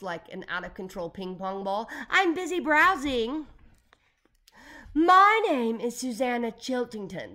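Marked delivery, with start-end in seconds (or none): 2.1-3.5 high, loud, stretched-out call; slow, high-pitched yell; shouting down. A narrator's reading. Calls down from careening ladder. 4.9-7.3 loud, high, grand declaration; slow, swooping operatic delivery. A narrator's reading. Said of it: "the lady said operatically".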